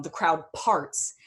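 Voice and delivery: normal talking voice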